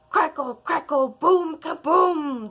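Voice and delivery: loud slow crackle and boom; thunder crash. Acted. Narrator voicing bellowing thunder, loud slow.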